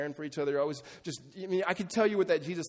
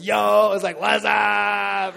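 loud, drawn-out mock holler; hollering to the boys; 5 semitones higher, 12 dB louder, much slower than usual. Greeting his boys from back home, exaggerated shout. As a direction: imitate a big shouted hometown greeting. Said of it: "talking to your boys or something like that".

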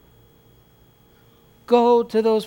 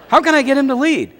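higher, louder mock-wife question; imitated wife; 7 semitones higher, 5 dB louder than usual. Wife asking how her husband can lead, imitated and insistent. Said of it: "The wives will say this".

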